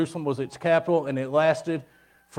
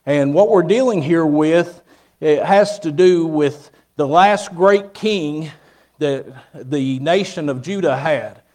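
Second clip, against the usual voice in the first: preaching; 6 dB louder than usual. Acted. Preaching to the congregation.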